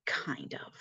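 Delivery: whispered sheepishly under the breath. Real, not acted. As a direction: admit it in a tiny unvoiced whisper. Sheepish admission after promise.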